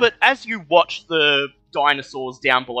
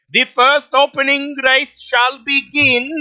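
loud, high announcer call; race announcer; 9 semitones higher, 5 dB louder than usual. Acted. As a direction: boom it out like a race caller. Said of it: "his voice magically amplified".

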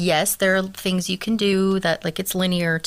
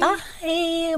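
voice cracking up an octave; 10 semitones higher than usual. Real, not acted. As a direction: voice jumps high and cracks. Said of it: "My voice cracked again".

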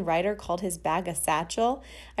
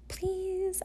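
pleading; 13 semitones higher, 5 dB quieter than usual. Retold or imitated. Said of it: "hoping against hope".

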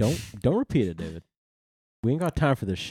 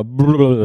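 lip trill; 7 dB louder than usual. Retold or imitated. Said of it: "I shake my face and go".

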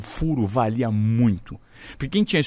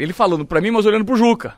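normal talking voice

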